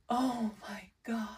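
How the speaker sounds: breathless whisper of awe; awed whisper. Real, not acted. Reacting to a perfect card match, awed whisper. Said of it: "Pardon my dramatic reactions".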